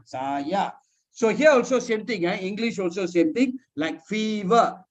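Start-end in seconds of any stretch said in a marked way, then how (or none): 0.0-0.7 "Saya" split as sa, ya; syllable-by-syllable. Shown as a demonstration. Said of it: "Sa is one". 4.0-4.8 "fever" slowly split as fee, ver; syllable-by-syllable. Shown as a demonstration. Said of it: "Fee is one syllable".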